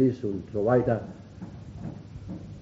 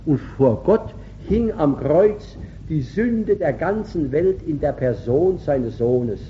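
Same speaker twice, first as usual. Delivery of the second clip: ordinary talk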